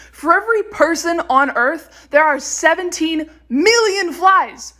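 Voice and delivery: normal talking voice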